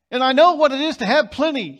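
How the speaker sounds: loud, driving sermon voice, pressing every point; fiery preaching. Acted. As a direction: preach it out full force to the congregation. Preaching at full volume.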